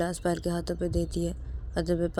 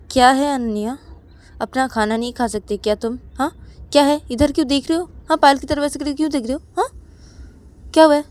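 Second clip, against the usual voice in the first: higher, louder, fast sharp scolding; scolding mother; 8 semitones higher, 10 dB louder than usual. Acted. Mother to Ananya, angrily scolding her. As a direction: snap at the staring child. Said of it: "Ananya की तरफ देखते हुए बोलती कि".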